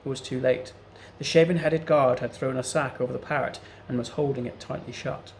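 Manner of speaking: ordinary delivery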